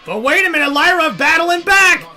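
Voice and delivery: live play-by-play, rising to shouts; wrestling commentator. Acted. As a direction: call the match like ringside commentary.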